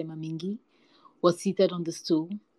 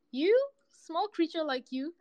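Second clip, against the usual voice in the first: high-pitched incredulous question; scornful disbelief; 11 semitones higher than usual. A narrator's reading. Hyena to the small cat, scornful disbelief. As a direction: query it with mock astonishment, pitched right up.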